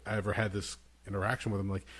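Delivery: normal talking voice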